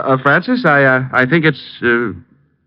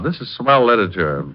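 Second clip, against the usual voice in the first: ordinary delivery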